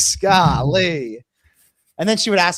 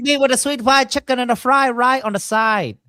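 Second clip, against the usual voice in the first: higher and louder, pressing the order home; eager, pushy food order; excited insistence; 8 semitones higher, 4 dB louder than usual. Real, not acted. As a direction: hammer out the order like a craving. Insisting on his order, repeated.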